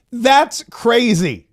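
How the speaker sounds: very slow, stretched "That's crazy" with "That's" leaned on; milk every syllable of "That's crazy" in ridicule; hammed-up drawl of "That's crazy"; exaggerated mock repeat. Mockingly repeating Bondi's quote again.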